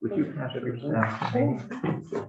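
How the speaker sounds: normal talking voice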